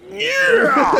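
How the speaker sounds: high, loud triumphant shout; a hammed-up jock roar; flexing dad yell. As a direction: bellow it like a proud dad mid-flex.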